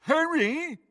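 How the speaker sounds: mock the friend's call in a squeaky imitation; mimicking. A narrator's reading. Henchman aping the friend's call, mocking mimicry. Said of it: "mimicked Crabbe".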